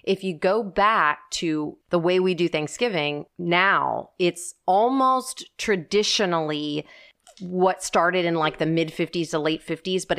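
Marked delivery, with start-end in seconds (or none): none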